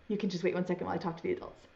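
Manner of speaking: fast, soft aside; quick quiet aside; rushed aside. Real, not acted. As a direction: hurry through it before turning to the grown-ups.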